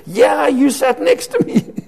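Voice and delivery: high, drawn-out mock insistence; insist, stretching the words out; insistent contradiction. Convert insisting they shared a plane, insistent contradiction. Acted insistence after the denial.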